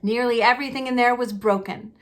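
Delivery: ordinary delivery, nothing marked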